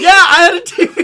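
high, loud laughing brag; gloating laugh. Real, not acted. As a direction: rub it in through laughter.

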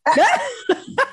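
a quick bright burst of laughter; short laugh. Real, not acted. Cohost laughing at their MC event, a short real laugh. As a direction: laugh it off, amused.

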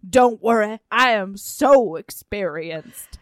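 loud, slow, hammed-up seducer; Bill. Bill reassuring inexperienced Suki, slow mock-seductive boast. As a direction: lay on the suave lover, drawn out. Acting out Bill's suave answer.

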